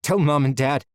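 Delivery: urgent plea. Acted. Urgent last words to sister.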